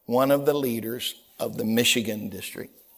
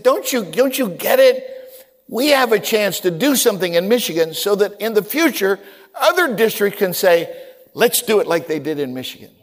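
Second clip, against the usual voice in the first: louder, higher, pressing the point; urgent pleading; 5 semitones higher, 4 dB louder than usual. Retold or imitated. Preacher urging the angry leader, pleading and loud. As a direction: plead hard to win him over. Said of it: "I said".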